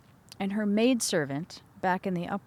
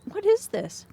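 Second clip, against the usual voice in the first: surprised question; 12 semitones higher, 3 dB louder than usual. Acted. Inanna questions at the gate.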